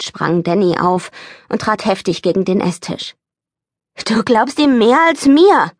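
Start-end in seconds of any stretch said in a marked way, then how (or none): 4.0-5.7 slow, higher and louder shout; yelling. A narrator's reading. Boy yelling at his mother before running upstairs, slow shout. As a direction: hurl it at her. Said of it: "schrie er".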